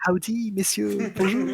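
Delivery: slow, hammed-up French accent howdy; thick French accent. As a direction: play a cowboy greeting in heavy French accent. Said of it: "the thickest French accent you can".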